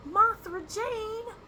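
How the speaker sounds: very high, loud, slow Southern holler; deep South accent. Shown as a demonstration. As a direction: holler the name like a Southern parent, drawn out. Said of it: "say it in a Southern accent".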